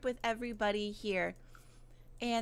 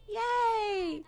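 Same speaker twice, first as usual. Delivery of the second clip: high, drawn-out yay; happy cheer; 7 semitones higher, 3 dB louder than usual. Real, not acted. Cheers the picture.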